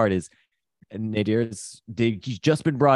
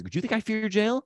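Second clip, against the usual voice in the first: mild mock defiance; 12 semitones higher than usual. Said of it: "he's yelling".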